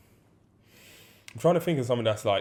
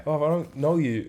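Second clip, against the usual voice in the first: higher, put-on dismissive comeback; dismissive reply; 6 semitones higher than usual. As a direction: shut the stranger down with mock scorn. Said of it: "I'm just like".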